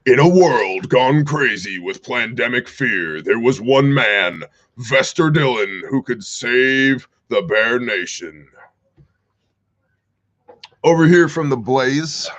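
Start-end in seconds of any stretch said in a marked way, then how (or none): 0.1-8.5 mock movie-trailer narrator; slow, dramatic trailer voiceover with drawn-out key words. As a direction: intone it like an epic film trailer. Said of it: "use my narrator voice".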